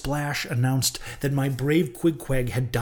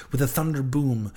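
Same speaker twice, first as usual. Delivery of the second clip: plain reading voice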